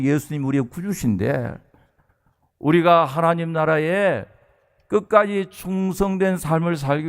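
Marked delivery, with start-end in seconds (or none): none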